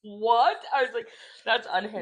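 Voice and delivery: loud, incredulous laughing outburst; laughing disbelief. Real, not acted. Woman telling her friend about an absurd dating message, laughing disbelief. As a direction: blurt it out through laughter.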